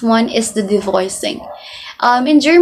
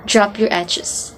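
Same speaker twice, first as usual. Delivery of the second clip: ordinary talk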